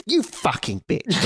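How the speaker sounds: retelling a train outburst with a mock angry yell. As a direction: ham up the drunk woman's furious yell. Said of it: "kind of really angry".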